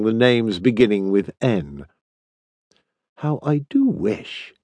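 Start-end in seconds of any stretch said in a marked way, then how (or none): 3.2-4.5 soft, slow wistful aside; murmured. A narrator's reading. Stephen confides a wish to Jack, murmuring. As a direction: murmur it slowly, just for a friend. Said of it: "he murmured to Jack".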